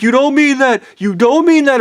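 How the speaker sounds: high, urgent protest; imitating screaming wives. Wives contradicting the screaming speaker, high imitated protest. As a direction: cry it out high, as the alarmed wives. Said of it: "they were screaming and telling me to stop".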